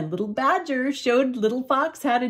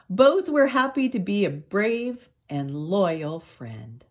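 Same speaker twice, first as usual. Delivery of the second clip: usual speaking voice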